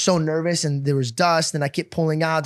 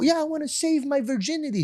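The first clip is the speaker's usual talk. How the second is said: mock innocent; 8 semitones higher, 6 dB quieter than usual. His younger self answering dad.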